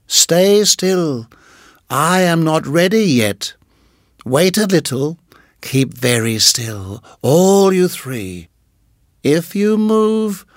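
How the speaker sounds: slow menacing snake voice. Acted. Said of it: "Nagaina menacing".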